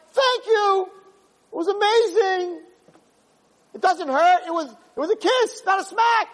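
high, shouted delight; overjoyed forgiver. Acted. The one who was hurt thanking the other, high and shouted. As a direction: gush with gratitude for the smack.

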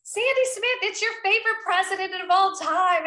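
high, hearty phone greeting, re-enacted; President Trump. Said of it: "President Trump goes".

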